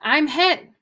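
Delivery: loud, high, gruff cry of alarm; excited gruff yell. Acted. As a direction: yell it out like you've just been struck. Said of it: "Mountie yelled excitedly in his gruff voice".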